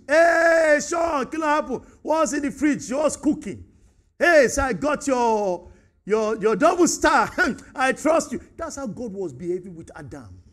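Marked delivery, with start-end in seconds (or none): 0.1-8.4 loud, high, cheery greeting; friendly banter. Retold or imitated. Preacher greeting Sean as a friend, loud and cheery. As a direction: hail a close friend happily. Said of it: "if I go to fellowship".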